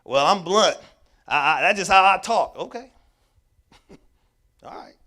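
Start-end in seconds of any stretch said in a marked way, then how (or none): 0.0-2.6 blunt. Retold or imitated. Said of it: "we think we encouraging people by talking to them in a certain way".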